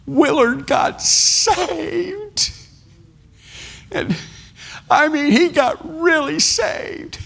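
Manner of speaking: very high, slow, weeping praise; tearful testimony. Retold or imitated. Joy weeping her testimony before the church, very high and slow. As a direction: testify through tears of joy.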